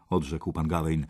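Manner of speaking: usual speaking voice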